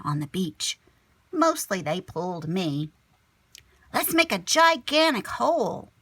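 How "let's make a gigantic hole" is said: put-on high, louder, eager kid; excited gasp. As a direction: burst out with a big idea. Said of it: "I gasped".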